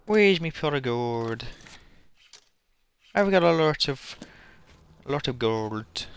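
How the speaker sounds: put-on hammy Irish accent hunting for a pot of gold; a not-very-good Irish accent, slowed down. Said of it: "It is an Irish accent".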